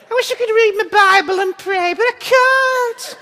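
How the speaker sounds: very high, squeaky puppet lament; high northern bird voice, stretching the last words into a wail; Orville. Acted. Self-doubting churchgoer as puppet bird, very high plaintive voice. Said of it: "Orville syndrome".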